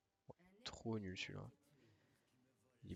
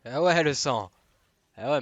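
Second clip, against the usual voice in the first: raging gamer; 6 semitones higher, 19 dB louder than usual. Real, not acted. Raging at enemy damage.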